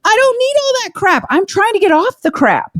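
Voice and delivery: pitched high, worked up and emphatic; fired-up rant. Real, not acted. Ranting to her co-host about supplements, high and fired up. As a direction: preach it like you're fed up.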